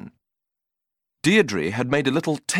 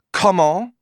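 puzzled French woman; 7 semitones higher, 5 dB louder than usual. Acted. French woman asks again.

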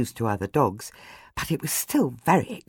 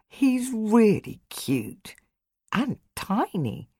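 tender; 8 semitones higher, slower than usual. Acted. Adam cooing over the puppy.

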